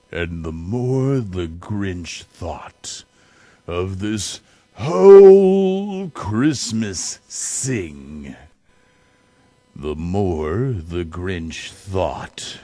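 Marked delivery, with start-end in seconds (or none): none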